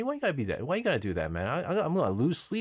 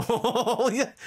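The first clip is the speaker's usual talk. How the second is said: laughing oh; 10 semitones higher, 3 dB louder than usual. Real, not acted. Laughing at the pictures.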